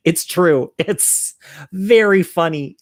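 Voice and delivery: laugh, then stretch out the words; real amusement lifting the voice. Real, not acted. Laughs at own confession.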